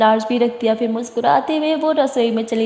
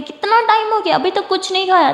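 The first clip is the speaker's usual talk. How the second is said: high-pitched cross demand; indignant, rising reproach; angry scolding; 7 semitones higher than usual. A narrator's reading. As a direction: scold a loved one in exasperation. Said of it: "Siya गुस्से से तारा को देखती है".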